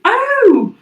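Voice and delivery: high, loud surprised oh; light up as it comes back; sudden recollection. Real, not acted. Suddenly remembers.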